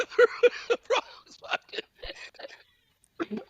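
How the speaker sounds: high, squeezed-out words through laughter; breaking up laughing. Real, not acted. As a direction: lose it laughing mid-sentence. Breaks up laughing at own story.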